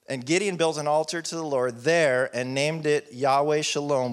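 usual speaking voice

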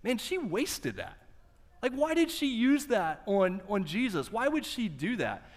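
high, indignant whine of the onlookers; a sermon aside, aping the onlookers' scorn for the waste; mocking disapproval. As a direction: mimic the grumbling critics with a sneer. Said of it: "what is all they can think of".

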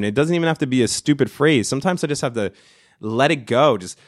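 normal talking voice

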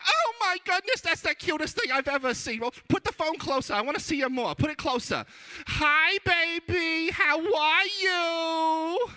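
shrieking high, gushing grandma impression; full grandma. Grandma seeing the baby on FaceTime, over-the-top high gushing. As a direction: coo loudly and way up high, stretching the baby talk. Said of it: "going full grandma".

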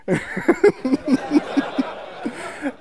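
long, high laugh; amused laugh. Real, not acted. Speaker recalls his friend's prank, long amused laugh. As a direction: laugh helplessly at your own story.

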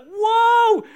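sudden high, loud yelp of fright; startled shout. Retold or imitated. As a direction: leap out of your skin, crying out.

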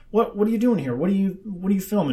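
confrontational. Retold or imitated. Man confronts interviewer about filming, mock confrontational. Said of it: "confrontational, yeah".

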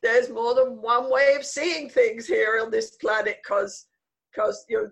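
amused, laugh bubbling into the line. Real, not acted. Remembering her two-world childhood, laughing.

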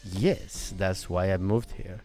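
put-on low, soft Norwegian-accented English; deadpan Norwegian accent. Newcomer admitting why he moved, low soft Norwegian accent. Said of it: "my Norwegian accent".

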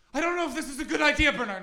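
high, loud, hammed-up posh lady; posh nervous woman. Woman at the station pleading with Bernard, high hammed-up posh voice. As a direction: squeal it like a frightened posh woman. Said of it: "she shit a broom".

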